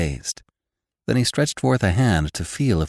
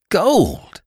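high-pitched burst of amazement; astonished exclamation; 13 semitones higher, 4 dB louder than usual. A narrator's reading. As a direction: exclaim it, stunned by the find. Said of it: "he ejaculated".

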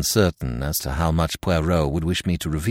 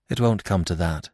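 ordinary delivery

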